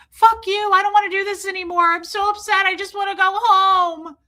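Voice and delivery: high, shouted meltdown, acted out; loud, high-pitched distraught outburst; hysterical bawling. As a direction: scream at the auditor in desperate upset. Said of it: "and I'm bawling".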